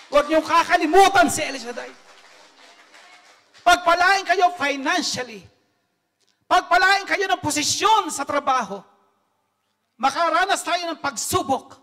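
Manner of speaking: rally the congregation; preaching at full force. Real, not acted. (masiglang pangangaral)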